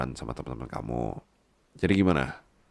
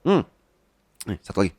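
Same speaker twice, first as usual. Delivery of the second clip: usual speaking voice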